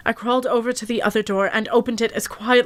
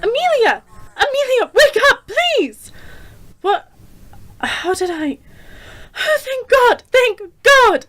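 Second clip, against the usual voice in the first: much higher, loud panicked pleading turning to relieved cries; frantic relief; 13 semitones higher, 5 dB louder than usual. Acted. Friend desperately wakes Amelia, then cries out in relief. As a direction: shake her awake in panic, then sob with relief.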